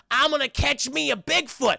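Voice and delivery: high, loud cartoon bragging; cocky declaration. Eustace after the free pass, cocky declaration. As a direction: proclaim the hunt with gusto.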